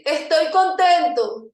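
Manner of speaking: loud, slow "Estoy contento" from a slumped pose; demo happy in slumped pose. Shown as a demonstration. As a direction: say "Estoy contento" slowly and loudly, shoulders down. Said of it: "Y me van a decir".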